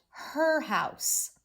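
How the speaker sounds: "her" drawn out and punched; stretched, stressed "her", then drop on "house"; emphasis on "her". Shown as a demonstration. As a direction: lean long and hard on "her". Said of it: "said the word her with emphasis".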